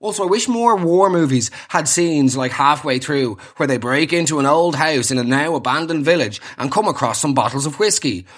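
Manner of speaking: normal talking voice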